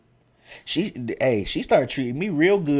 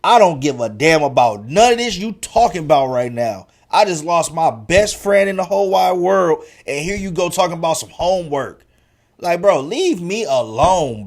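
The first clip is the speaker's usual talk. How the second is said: louder, higher angry snap at the teacher; fed-up retort; 5 semitones higher, 6 dB louder than usual. Retold or imitated. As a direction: tell her to leave you alone, boiling over. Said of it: "I'm like".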